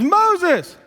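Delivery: high, loud call of the name; the bush calling Moses loudly; voice of God calling. Acted. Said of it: "It says".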